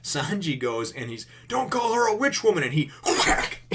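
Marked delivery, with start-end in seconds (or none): 1.5-2.6 high, loud imitation of outrage; indignant mock yell. Sanji defending Nami to Zoro, indignant mock yell. 3.0-3.6 loud, sudden impact noise; imitated whack. Narrator imitating Sanji's kick to the face, comic whack.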